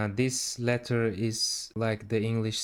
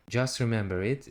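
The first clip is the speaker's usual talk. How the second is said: usual speaking voice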